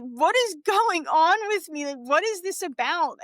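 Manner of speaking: high-pitched, baffled plea to oneself; bewildered self-questioning. Retold or imitated. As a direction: ask yourself in mild, high bewilderment. Acting out bewildered inner reaction while crying.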